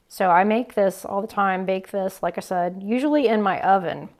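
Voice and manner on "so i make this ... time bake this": ordinary delivery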